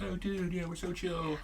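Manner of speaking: mock Californian. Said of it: "Californians are like".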